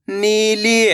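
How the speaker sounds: नीली with both ee sounds stretched; नीली, held long for learners; drawn-out long vowels. Shown as a demonstration. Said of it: "तो आपको खींच के पढ़ना है".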